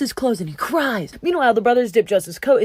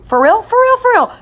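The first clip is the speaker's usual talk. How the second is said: loud, high, rising hype; overjoyed cellmates; excited disbelief; 11 semitones higher, 8 dB louder than usual. Acted. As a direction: bounce each repeat higher in giddy excitement. Prisoners hyped about dream reading.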